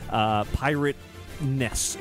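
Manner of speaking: ordinary delivery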